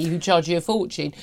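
ordinary delivery

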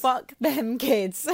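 slow, mock-callous repeat; deadpan sarcasm. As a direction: drag it out, deadpan.

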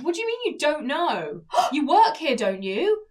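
hammed-up customer's disbelief; indignant customer. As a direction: challenge as an affronted shopper. Voices customer challenging her.

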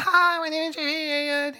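high, put-on salesman greeting; put-on phone voice. Friend mocks a phone sales script in a high put-on voice. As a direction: chirp it like a cold caller reading a script.

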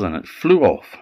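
usual speaking voice

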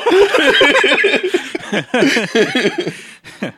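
loud, high cackling laugh; high burst of laughter. Real, not acted. Cohost bursts out laughing at the slumlord joke, loud and high. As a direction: crack up hard at your own joke. Cohost cracks up at own bit.

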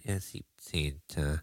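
slow, dazed and drowsy; groggy from anesthesia. Acted. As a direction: mumble it half asleep. Confused old woman, groggy. (benommen wie nach der Narkose)

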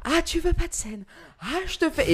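high, mocking theatrical taunt; drama queen. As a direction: ham up a hysterical scene. (drama queen outrée et moqueuse)

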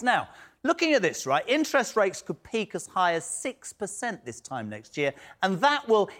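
measured presenter read to camera; news presenter read. Acted. Host reading the news link to his viewers. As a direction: introduce the story and the guests like an anchor.

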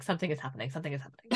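anxious dog voice. Retold or imitated. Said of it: "a level of stress that they're like".

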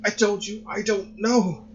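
high, slow, tearful wail; crying. A narrator's reading. Son crying at his mother, slow and high. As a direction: drag it out through sobs. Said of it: "he cried".

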